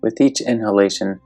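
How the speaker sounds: normal talking voice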